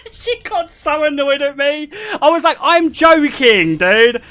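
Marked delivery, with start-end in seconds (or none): 2.6-4.2 exaggerated replay of defending a joke; retold protest. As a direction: protest it loudly, stretching the words through laughter. Retold protest to annoyed date.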